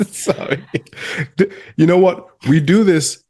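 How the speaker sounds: high, giggly recovery from a laughing fit; still laughing. Real, not acted. Apologising to co-host after cracking up, laughing. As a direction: squeeze words out through leftover laughter. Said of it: "I'm just laughing because".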